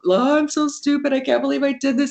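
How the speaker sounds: high, fast, self-pitying wail; despairing wail. Retold or imitated. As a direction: pitch it up into a dramatic moan. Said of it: "having my moment with my friend".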